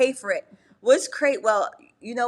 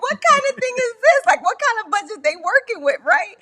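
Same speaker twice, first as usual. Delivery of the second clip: incredulous; 11 semitones higher, 3 dB louder than usual. Retold or imitated. Continues retold outrage.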